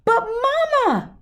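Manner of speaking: high, slow whiny cub plea; pleading cubs. Acted. Cubs begging Mama over the TV ban, high whine. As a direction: drag out the protest like a kid.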